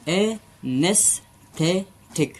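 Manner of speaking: "Anaesthetic" बहुत धीरे: "ए… नेस… थे… टिक"; "एनेस्थेटिक" के हर सिलेबल के बाद रुकें; चार हिस्सों में. Shown as a demonstration. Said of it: "चार हिस्सों में हमने बोला". ("एनेस्थेटिक" सिखाते हुए चार अलग टुकड़ों में)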